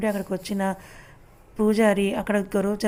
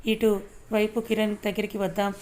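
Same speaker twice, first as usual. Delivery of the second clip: usual speaking voice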